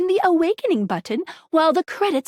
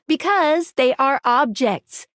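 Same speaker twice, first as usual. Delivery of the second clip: ordinary talk, nothing marked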